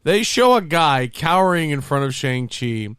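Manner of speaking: ordinary talk, nothing marked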